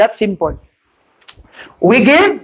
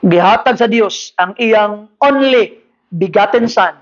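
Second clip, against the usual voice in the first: usual speaking voice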